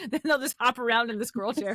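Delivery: ordinary talk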